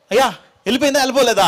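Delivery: fast, loud, high anxious question; anxious father. Acted. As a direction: blurt it out worried.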